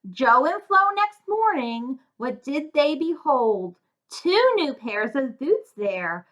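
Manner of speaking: plain reading voice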